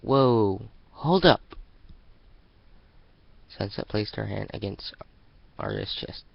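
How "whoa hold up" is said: much louder, rising shout; sharp stop. Acted. Sunset stopping Arya at the door with a shout. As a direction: halt her right there.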